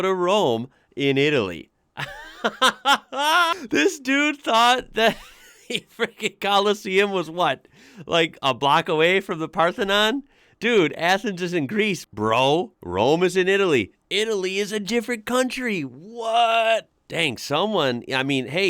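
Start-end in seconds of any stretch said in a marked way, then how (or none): 2.0-7.7 high, laughing hard through the words; helpless high-pitched laughing disbelief; cracking up. Real, not acted. Cracks up at the tourist's mistake. 8.1-16.9 high, loud-edged disbelief climbing to a squeal; can't believe anyone is this clueless; exasperated, amused disbelief rising in pitch; incredulous. Real, not acted. Mocking disbelief at tourist's geography mistake.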